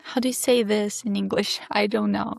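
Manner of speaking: ordinary talk, nothing marked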